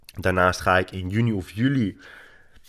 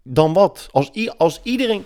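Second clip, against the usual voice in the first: mock indignant objection; 8 semitones higher than usual. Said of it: "de eerste reactie die je dan vaak krijgt is".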